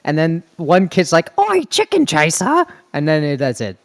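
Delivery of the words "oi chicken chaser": put-on high, cheeky British kid shout; British accent. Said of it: "That's a terrible accent".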